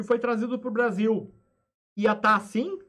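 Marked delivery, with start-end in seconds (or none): none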